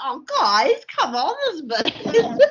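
slow, high words broken by laughter; can barely talk for laughing; laughing through words. Real, not acted. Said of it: "we cracked up laughing".

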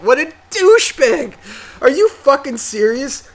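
high, loud disbelief; incredulous outburst. Real, not acted. Host reacting to the listener's bedroom story, incredulous. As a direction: burst out loudly, pitch way up.

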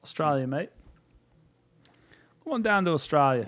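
exaggerated Australian accent, playful; mock Australian accent. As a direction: sell Australia like a cheesy tourist ad, Aussie accent. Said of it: "It's Australian".

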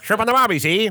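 high-pitched mock Australian accent; a hammy tourist's Australian accent. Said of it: "I don't think I'm that good".

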